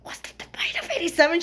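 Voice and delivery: quick excited whisper. Retold or imitated. Whispering the meme to friends in the theater, hushed whisper. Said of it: "I whispered to my friends".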